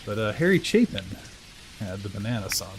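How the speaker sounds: usual speaking voice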